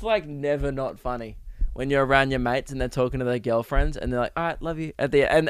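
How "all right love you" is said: hushed, sheepish mock sign-off; imitated furtive whisper. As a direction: softly mimic a mate hiding it. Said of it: "And they try and whisper it".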